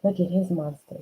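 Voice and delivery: usual speaking voice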